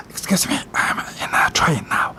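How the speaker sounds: urgent stage whisper into a phone; forced, audible whispering; mock whisper. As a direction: imitate a hushed excuse on the train. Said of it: "It's like this".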